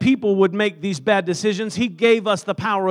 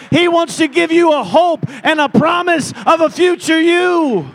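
loud, rising preacher's exhortation; full-force preaching; 4 semitones higher, 7 dB louder than usual. Acted. Pastor urging the congregation, preaching loud and rising. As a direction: preach it hard to the house.

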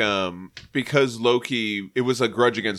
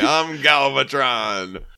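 Leonard Nimoy as Galvatron; much slower than usual. Retold or imitated. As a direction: drag it out like a villain robot. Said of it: "Leonard Nimoy".

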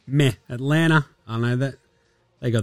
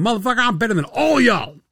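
higher, brash trash-talk voice; cocky player boast; 8 semitones higher than usual. Pretend. Imagined rookie brags to his teammates, brash and higher. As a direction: puff up and brag at the whole locker room. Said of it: "Cam Whitmore going".

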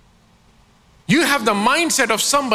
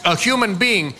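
normal talking voice

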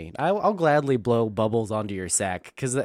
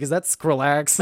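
laughing self-quote; 4 semitones higher, 5 dB louder than usual. Retold or imitated. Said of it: "I'm over here like".

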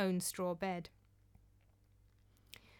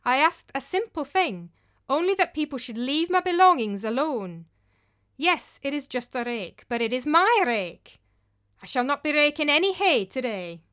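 louder, higher, a firm stand; proud defiance; 4 semitones higher, 7 dB louder than usual. Acted. Thorgunna refuses the group, firm and proud. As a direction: stand tall and refuse flatly. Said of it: "drawing herself up to her full height".

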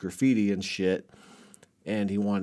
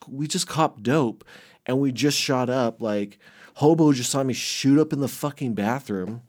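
ordinary talk, nothing marked

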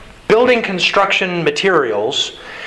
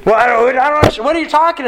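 higher, louder mock-exasperated protest; exasperated confusion; 9 semitones higher, 3 dB louder, much faster than usual. Coworker lost in the mix-up, hammed-up exasperation. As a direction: act fed up with not understanding. Acted confused worker in misunderstanding.

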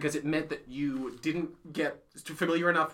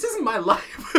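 mock indignant retort; 6 semitones higher, 5 dB louder than usual. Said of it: "It's like".

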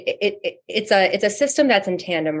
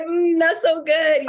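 high, slow savoring murmur; savoring mmm; 10 semitones higher, slower than usual. Retold or imitated. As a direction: purr it like tasting food. Imitating her own appreciative noises.

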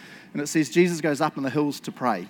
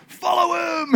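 crowd shout; 14 semitones higher, 4 dB louder than usual. Acted. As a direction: call out like an excited crowd giving chase. Acted crowd calling to chase Jesus.

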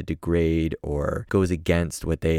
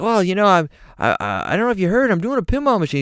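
high hesitant rocker voice; 12 semitones higher, 5 dB louder, faster than usual. Retold or imitated. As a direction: ease into the pitch, pitched up. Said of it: "Slash like".

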